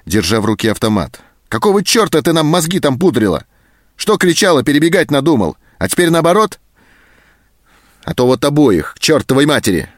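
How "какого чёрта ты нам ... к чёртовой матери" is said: loud, much higher raging yell; furious tirade. A narrator's reading. Sergeant threatening to shoot both, furious yell. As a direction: rage at her, threatening. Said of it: "взъярился сержант".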